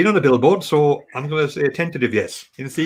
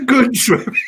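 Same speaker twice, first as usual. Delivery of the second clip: high, loud "Good swimming" with the stress on "Good"; loud mock echo; 8 semitones higher, 5 dB louder, slower than usual.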